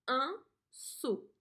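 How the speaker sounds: un seau said slowly and clearly; dictation clear. Shown as a demonstration. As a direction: stress seau for spelling. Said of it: "cela s'écrit d'une manière bien particulière". (un seau articulé)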